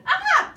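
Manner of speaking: high shrill-pitched loud shriek; sudden startled shriek; platypus scream. Acted. The startled platypus screaming at George. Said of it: "the creature screamed".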